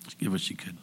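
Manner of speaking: breathy whisper, barely voiced. Real, not acted. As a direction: let it drop to a whisper for emphasis. Preacher whispers the widow's point.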